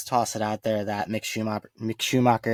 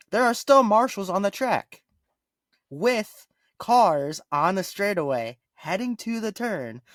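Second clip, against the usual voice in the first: incredulous rant; 8 semitones higher than usual. Real, not acted. Ranting about marshals on track.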